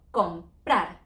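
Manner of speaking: comprar stressed on PRAR; slowly, com-PRAR with weight on the end; drawn-out comprar, last syllable hit; stress on final syllable. Shown as a demonstration. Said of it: "It's an R".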